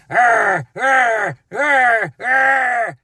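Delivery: loud wordless grunting shouts; inarticulate yelling. Retold or imitated. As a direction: roar like someone beyond speech. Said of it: "screaming and yelling as you drive by".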